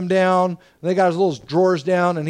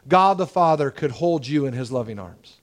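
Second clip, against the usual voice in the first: usual speaking voice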